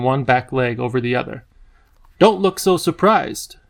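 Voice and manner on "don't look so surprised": lightly voiced regal creature, slow and a bit higher; the Whangdoodle teasing the startled children, lightly voiced and unhurried; Whangdoodle with a lisp. A narrator's reading. Children startled, the Whangdoodle chides them gently, slow and lightly voiced. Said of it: "His voice was deep, and he spoke with engaging lisp".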